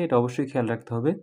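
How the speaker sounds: ordinary delivery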